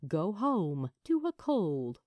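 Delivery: ordinary delivery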